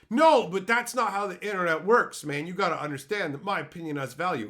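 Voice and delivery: loud, high indignant opening easing off; mock protest. Commenter insisting his opinion has value, mock indignant. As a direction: burst in as an offended commenter, then rattle on.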